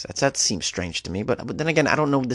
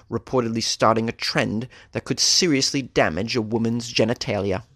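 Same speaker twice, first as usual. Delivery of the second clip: ordinary talk, nothing marked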